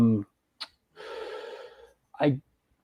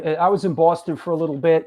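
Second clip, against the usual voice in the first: usual speaking voice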